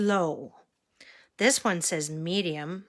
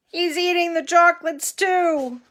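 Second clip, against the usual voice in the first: surprised; 10 semitones higher, 6 dB louder than usual. Acted. Amused remark to child.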